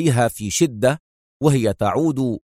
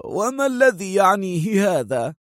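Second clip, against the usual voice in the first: higher, scornful question; pitched-up mock disdain; dismissive; 7 semitones higher than usual. A narrator's reading. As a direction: brush it off with contempt. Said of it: "في استخفاف".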